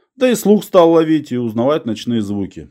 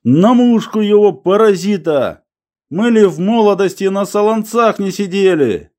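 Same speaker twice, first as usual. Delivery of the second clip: high, fired-up boasting; old man's bravado; 10 semitones higher, 3 dB louder than usual. A narrator's reading. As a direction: work yourself up into fighting talk. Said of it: "Дед даже затрясся". (старик громко, запальчиво храбрится)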